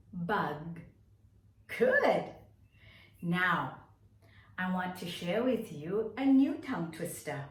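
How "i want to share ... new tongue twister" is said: ordinary delivery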